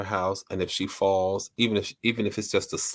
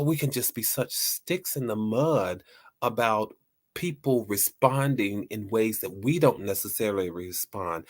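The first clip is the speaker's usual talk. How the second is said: usual speaking voice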